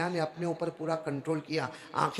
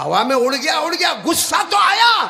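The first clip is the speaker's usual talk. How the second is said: loud, higher indignant shout; angry shout; 9 semitones higher, 12 dB louder than usual. Retold or imitated. Avicenna shouting back at the maulana, angry loud. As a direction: blurt it out, still furious. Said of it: "ابو علی سینا نے کہا".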